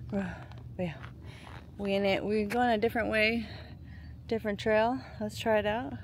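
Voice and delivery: breathless from the hike; panting between words; out of breath. Real, not acted. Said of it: "I'm out of breath".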